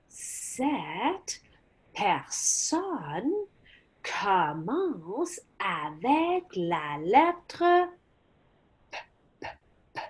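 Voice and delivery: soft and slow, building suspense; hushed hint. Shown as a demonstration. Teacher softly teasing the class with the first letter. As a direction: tease out the letter.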